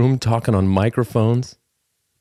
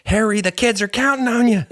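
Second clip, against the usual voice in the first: much higher whiny voice urging Harry on; high-pitched mock mom; 13 semitones higher than usual. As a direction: shriek at Harry like a frantic game-show mom. Quoting Cindy again.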